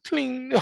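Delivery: one very high soft plink; ping like a piano key; piano plink imitation. Mimicking piano note.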